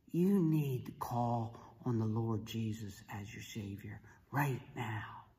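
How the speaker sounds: slow, hushed, low plea; solemn urging. Retold or imitated. Referee urging dying friend to pray, hushed and solemn. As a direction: drop to a near whisper, earnestly.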